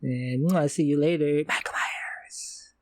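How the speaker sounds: soft, slowing whisper; whispered sign-off. Acted. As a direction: let it trail into a slow whisper. Hushed mock farewell in recap.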